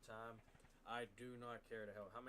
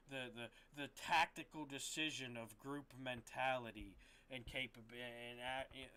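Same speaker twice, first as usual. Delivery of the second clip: normal talking voice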